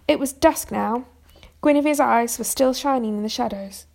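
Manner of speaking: plain reading voice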